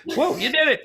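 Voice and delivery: high, amazed and delighted; amazed whoa. Retold or imitated. Paramedic to his buddy, amazed. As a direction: marvel at the comeback. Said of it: "You know, like".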